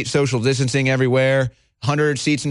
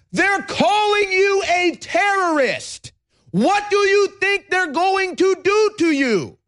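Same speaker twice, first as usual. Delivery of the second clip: yelling; 13 semitones higher than usual. Real, not acted. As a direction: yell it at the audience, outraged. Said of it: "I'm sorry, I'm yelling".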